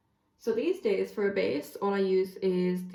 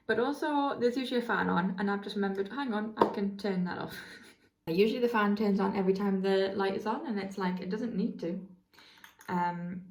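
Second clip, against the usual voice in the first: ordinary talk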